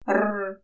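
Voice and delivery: the sound "Р" said as a guttural, rolled-back burr R. Acted. Boy showing the girl his burred "Р", throaty R. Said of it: "Чуєш, яке гаркаве?".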